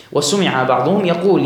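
ordinary delivery

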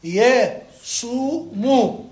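يصوم split slowly as ya, suu, mu; syllable by syllable. Shown as a demonstration. Teacher showing the vowels of the verb, يصوم slowly syllable by syllable. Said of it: "فتح، ضم، سكون، ضم".

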